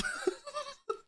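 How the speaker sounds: helpless squeaky giggle; high squealing laugh. Real, not acted. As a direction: crack up high. Laughing after retold scream.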